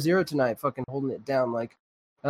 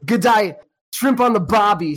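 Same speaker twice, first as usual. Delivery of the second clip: loud, higher hammed-up Aussie; mock Australian accent; 6 semitones higher, 6 dB louder than usual. Host mocking Aussie catchphrases before a clip, mock Australian accent. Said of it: "said no Australian ever".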